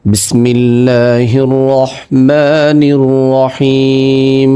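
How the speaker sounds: slow melodic tajweed chant; Quran recitation. Shown as a demonstration. Teacher opening the reading lesson, chanted slowly. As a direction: draw every vowel out like a reciter. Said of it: "তাহলে আসুন ভিডিওটি শুরু করা যাক".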